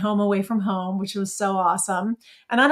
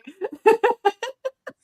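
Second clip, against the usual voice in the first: high bright burst of laughter; 13 semitones higher than usual. Real, not acted. Laughs at photo of Lori.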